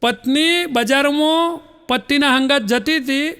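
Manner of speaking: usual speaking voice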